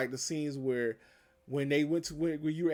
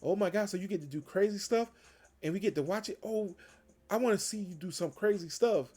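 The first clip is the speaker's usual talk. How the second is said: put-on excited kids; 6 semitones higher than usual. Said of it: "the kids was like".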